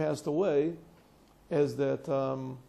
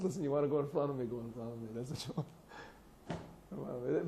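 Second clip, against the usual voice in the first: much softer and slowing right down, unbothered; calm giving way; 2 semitones lower, 9 dB quieter than usual. Retold or imitated. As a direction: let him go ahead, quietly yielding. Calm reply to a rude man.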